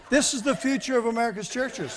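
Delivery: high, laughing lament; loud, high, laughing sarcasm; laughing mock despair. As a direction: say it through laughter, shaking your head. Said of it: "and I'm going".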